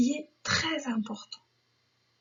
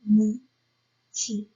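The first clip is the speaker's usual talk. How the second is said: "Amitié" slowly split as A, mi, tié; slow teacher's dictation of "Amitié"; syllable by syllable; 2 semitones lower, 3 dB louder, much slower than usual. Shown as a demonstration. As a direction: dictate "Amitié" with a clear pause between syllables. Teacher breaks word into syllables.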